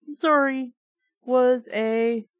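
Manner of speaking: usual speaking voice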